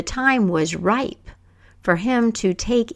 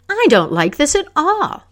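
much higher, louder protest; outraged queen mother; 9 semitones higher, 5 dB louder than usual. A narrator's reading. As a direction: object sharply in front of the court. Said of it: "And she was outraged".

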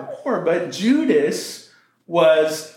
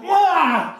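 startled cry; 11 semitones higher than usual. Acted. Judas shocked at the perfume.

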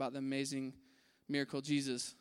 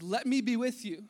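begging; 5 semitones higher, 5 dB louder than usual. Retold or imitated. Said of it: "He's begging him".

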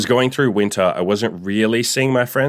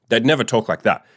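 ordinary delivery

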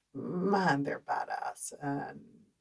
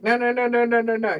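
louder, higher panicked string of no; a louder, higher mock-frightened protest; 7 semitones higher, 10 dB louder than usual. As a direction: plead in retold panic. Tarantula about to go on leg.

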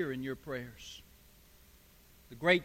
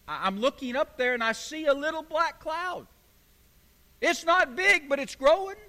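raised pitch, mounting excitement; excited report; 6 semitones higher than usual. Acted. Servant back to Elijah on the seventh time, excited. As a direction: report the sighting with rising hope.

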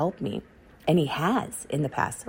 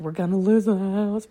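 retold bawling; 4 semitones higher, 4 dB louder than usual. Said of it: "I called my husband and I'm bawling".

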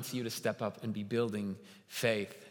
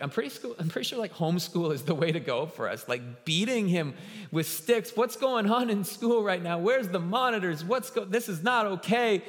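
high, louder, aghast dad; alarmed outburst; 7 semitones higher, 3 dB louder than usual. Retold or imitated. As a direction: go up in shock at the news. Dad alarmed at playground beating.

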